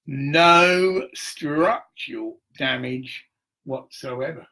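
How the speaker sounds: slow, deliberate radio-bulletin read; mock official announcement. Telling viewers about the fire, mock slow official bulletin. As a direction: announce it like a formal dispatch, slowly.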